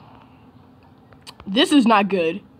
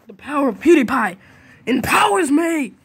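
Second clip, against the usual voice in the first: shouted battle cry; 6 semitones higher, 6 dB louder than usual. Real, not acted. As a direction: proclaim it loudly, climbing high on the end. Same line redone as a battle cry.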